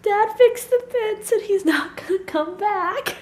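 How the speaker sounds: imitating heartbroken child. Daughter fearing the dog won't return, imitated heartbreak. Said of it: "And she was so heartbroken".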